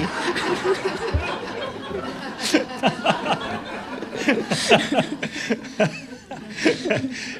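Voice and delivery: long high breathy laugh. Real, not acted. Laughs at the fond memory.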